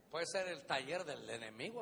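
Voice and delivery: usual speaking voice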